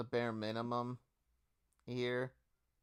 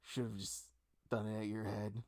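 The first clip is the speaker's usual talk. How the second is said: soft, embarrassed grumbling to oneself; embarrassed self-reproach; 3 semitones lower, 8 dB quieter than usual. Acted. Game master voices the embarrassed foe berating himself, softly embarrassed. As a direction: let it sink quieter as you scold yourself. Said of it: "He looks embarrassed".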